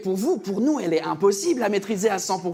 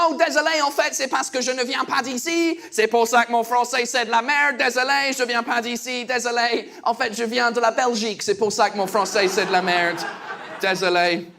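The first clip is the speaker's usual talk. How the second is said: lightly put-on Belgian accent, apologetic; fake Belgian accent. Customer explaining himself to the baker in a fake Belgian accent. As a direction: fake a Belgian accent to excuse your French. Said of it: "j'ai repris un faux accent".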